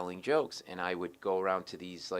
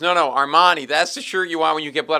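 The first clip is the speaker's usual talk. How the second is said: loud, higher, insistent colleague; retold correction; 6 semitones higher, 13 dB louder than usual. Second lawyer corrects colleague on shirts, loud insistence. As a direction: cut in and overrule the other expert.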